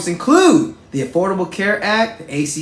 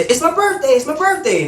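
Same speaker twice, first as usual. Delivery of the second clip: high, a bit louder, eager; excited plea; 12 semitones higher, 3 dB louder than usual. Retold or imitated. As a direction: make sure he hears it's your birthday. Shouting to the President at rally.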